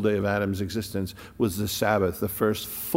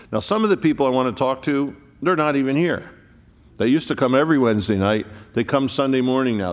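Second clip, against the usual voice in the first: louder, higher, faster sermon lines; fervent preaching; 4 semitones higher, 6 dB louder, faster than usual. Acted. Preacher scolding lapsed members, loud and fast. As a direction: press the point hard on the church.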